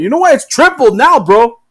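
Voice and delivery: hammed-up bully tripling the price; mock gloating bully. USA stand triples the fee, loud mock gloat.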